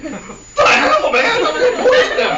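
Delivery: high, loud yell cracking with laughter; mock outraged yell. Dimitri on the unkillable Rasputin, hammed-up outraged yell. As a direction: shriek it as the baffled poisoner.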